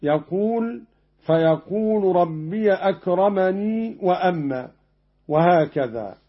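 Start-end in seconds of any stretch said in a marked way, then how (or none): 1.2-4.7 أكرمني recited "akramani" with the final ya kept when connected. Shown as a demonstration. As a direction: say "rabbiya akramani" with the ya sounded. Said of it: "وإذا وصل يقول".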